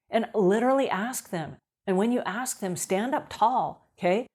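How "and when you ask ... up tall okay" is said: normal talking voice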